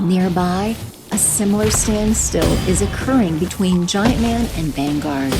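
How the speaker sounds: normal talking voice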